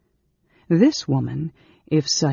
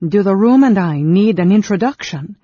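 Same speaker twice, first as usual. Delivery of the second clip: high, loud, cutting retort; sharp question; 5 semitones higher, 8 dB louder than usual. A narrator's reading. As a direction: snap the question back hard. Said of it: "the guest asked sharply".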